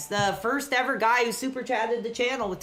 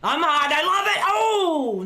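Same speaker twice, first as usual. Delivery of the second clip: much higher, loud, hyped-up yelling; excited; 8 semitones higher, 5 dB louder, slower than usual. Real, not acted. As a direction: cheer like a fan losing it. Hyped by big suplex.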